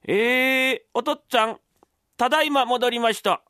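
high, put-on dutiful son voice; mimicked son's greeting, pitched high; imitating the young master. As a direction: call up politely in an imitated voice. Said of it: "俺の声色がうまい".

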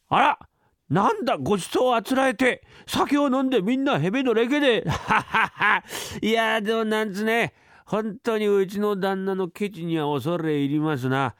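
put-on high, merry drunk servant laughing; a servant well into the sake; tipsy servant. Drunk servant mocks the stingy master, high and merry.

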